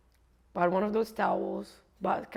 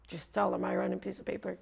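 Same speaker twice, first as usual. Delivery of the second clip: ordinary delivery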